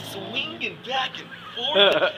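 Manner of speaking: very slow, stretched-out words; drawn-out swaying. Pretend. Comic describing long prayers as exercise, drawn out like swaying. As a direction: draw it out to mime the swing.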